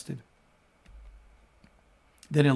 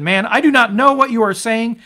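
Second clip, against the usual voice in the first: loud, higher protest; heated denial; 8 semitones higher, 9 dB louder than usual. Acted. Preacher as Peter, loudly denying he knows Jesus. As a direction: push the accuser away with your voice.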